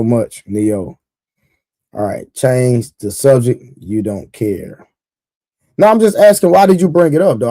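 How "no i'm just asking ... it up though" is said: defensive, raised voice. Real, not acted. Defensive pushback at chatter.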